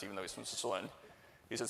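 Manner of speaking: normal talking voice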